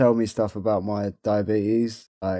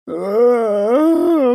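high whimpering groan; mock cry; 11 semitones higher, 6 dB louder than usual. Real, not acted. Reacts to high reading.

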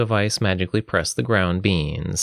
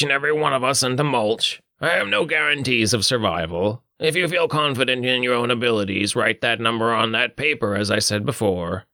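higher, distinct character voice; 4 semitones higher than usual. Acted. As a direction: speak as a stern quest giver. Character addresses the table.

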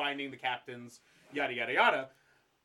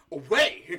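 sudden loud bark of the word; hammed-up loud command; mock shout; 9 dB louder than usual. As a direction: yell it like an order. Mock stern order to stow gear.

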